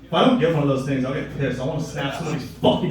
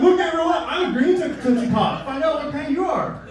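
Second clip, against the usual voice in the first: mocking quiz poster; 11 semitones higher than usual. Comic mocking quiz posters, high chirpy voice. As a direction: squeal it like a gushing show-off.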